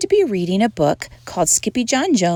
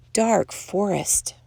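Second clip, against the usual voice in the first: plain reading voice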